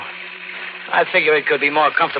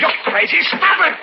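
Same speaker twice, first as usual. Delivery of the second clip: panicked shout. Acted. Drifter fighting off the knifeman, panicked shout. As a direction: scream at him to stop.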